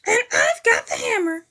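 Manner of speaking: high, loud eager shout; high loud cheer; Gonzo shouting. A narrator's reading. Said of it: "shouted Gonzo the Kid".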